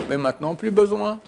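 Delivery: ordinary talk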